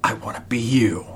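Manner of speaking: breathy secret whisper. Pretend. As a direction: whisper it like a confession. Voicing his inner wish.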